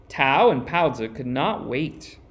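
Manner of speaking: usual speaking voice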